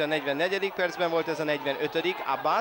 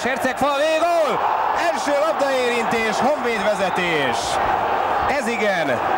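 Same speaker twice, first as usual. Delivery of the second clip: excited goal call; 4 semitones higher than usual. Real, not acted. Commentator calls a goal.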